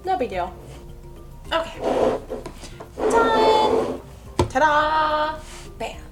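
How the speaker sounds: usual speaking voice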